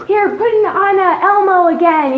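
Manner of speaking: frazzled mom. Pretend. Imagined tired mom.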